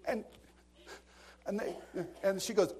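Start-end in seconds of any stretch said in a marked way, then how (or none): none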